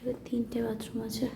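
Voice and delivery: breathy whisper, barely audible. Real, not acted. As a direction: whisper it so only the camera hears. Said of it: "শুনতে পাচ্ছ না?". (ফিসফিস করে)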